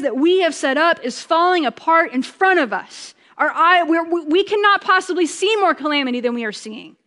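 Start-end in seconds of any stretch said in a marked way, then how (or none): none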